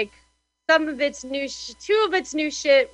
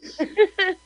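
embarrassed laugh; 6 semitones higher than usual. Real, not acted.

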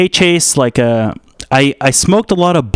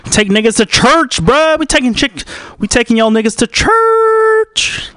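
hyped shout; 10 semitones higher than usual. Acted. Rallying the listeners like a preacher, rising hyped shout. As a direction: whip the crowd up like a revival.

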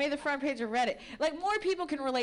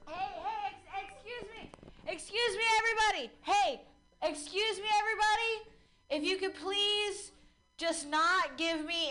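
higher mock announcer calling for attention; mock announcement; 6 semitones higher than usual. Comic pretending to grab the room's attention, mock announcer. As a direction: play a pushy announcer at the mic. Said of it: "I'm just talking really loud".

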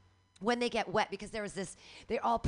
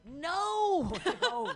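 high, laughing mock wail of dismay; 11 semitones higher, 4 dB louder than usual. Retelling a botched tattoo, mock wailing no. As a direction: wail over the wrong tattoo. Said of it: "and you're like".

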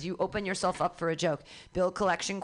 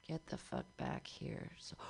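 hushed mock yell; 15 dB quieter than usual. Host redoes spirit line.

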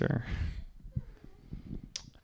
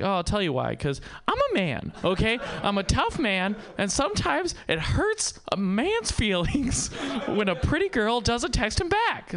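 mock wounded tough man; 7 semitones higher than usual. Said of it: "And I was like".

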